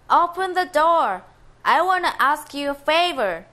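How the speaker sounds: high, loud call at the door. Acted. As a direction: shout up to be heard. Tiny boy calls at gate.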